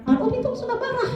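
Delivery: suara anak tinggi sambil tertawa; anak meledek. Retold or imitated. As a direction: goda ibunya dengan tawa. Anak menuduh ibu marah. (anak meledek sambil tertawa)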